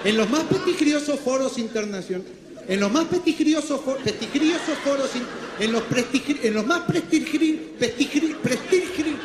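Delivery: stumbling restarts on "prestigiosos", pitch climbing; garbled "prestigri-", breaking off and retrying; tongue-tied. Acted. Stumbles repeatedly over prestigiosos.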